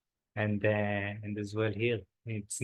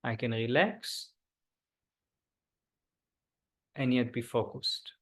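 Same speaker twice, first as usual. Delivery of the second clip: guided meditation. Acted. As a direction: ease the group into stillness. Leads a body scan meditation.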